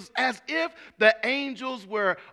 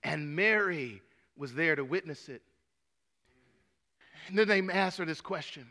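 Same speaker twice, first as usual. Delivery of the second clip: ordinary talk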